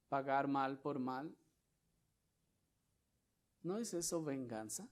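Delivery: ordinary talk, nothing marked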